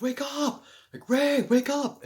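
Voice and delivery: high, loud shout to wake; frantic shout of wake up. Retold or imitated. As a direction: jolt yourself awake with a yell. Inner voice waking him.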